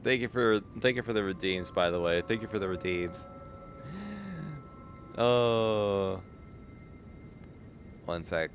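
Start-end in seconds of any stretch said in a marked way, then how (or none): none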